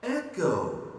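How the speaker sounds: faraway echo, high and falling; echo calling back. Acted. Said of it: "called back the echo".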